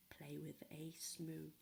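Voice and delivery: hushed, secretive whisper; whispered warning. Acted.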